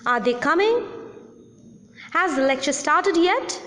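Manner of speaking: yes-no questions ending on a rise; rising question. Shown as a demonstration. Teacher demonstrating rising yes-no questions. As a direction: lift the pitch up on "coming" and "yet". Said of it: "yes no questions, rising tone is used".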